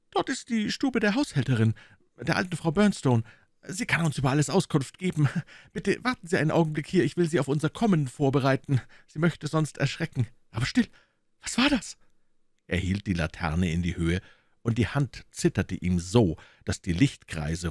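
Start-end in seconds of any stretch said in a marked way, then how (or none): 0.1-12.0 high, quick, a bit hushed fretful voice; a nervous man explaining in the dark; Thaddeus, high and anxious; uneasy gentleman. Acted. Thaddeus, startled at the end.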